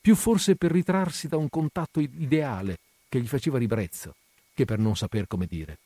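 ordinary delivery, nothing marked

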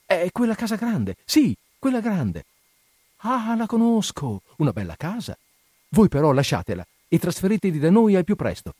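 very high, lively exchange; excited, delighted lovers. A narrator's reading. Two lovers arranging where he will live, excited and high-pitched. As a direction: gush over the house, stretching the recognition.

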